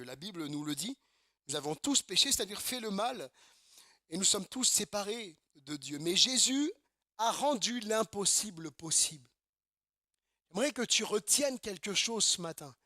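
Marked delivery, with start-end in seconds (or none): none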